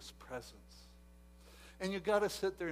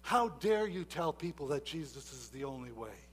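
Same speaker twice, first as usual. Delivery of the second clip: normal talking voice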